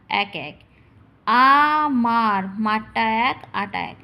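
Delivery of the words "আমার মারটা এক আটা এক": broken into syllables. Shown as a demonstration. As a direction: drag out each syllable. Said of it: "প্রথমে ভেঙে নেবে".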